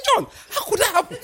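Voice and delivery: very high, loud comic disbelief; incredulous outcry. Retold or imitated. Preacher retelling his protest to Elder John, incredulous high cry. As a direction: exclaim in mock shock, close to laughter. Mock shock at friend's confession.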